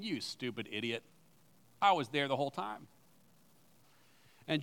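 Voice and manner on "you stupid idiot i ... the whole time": quiet, low, gentle rebuke; a friend teasing you kindly, softly; soft friendly scolding. Retold or imitated. Jesus to a doubting friend, a soft fond rebuke. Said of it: "a friend coming along and saying".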